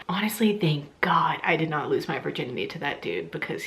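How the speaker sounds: whispered aside. Real, not acted. Vlogger whispering a secret aside to her viewers. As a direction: drop to a whisper for a confession.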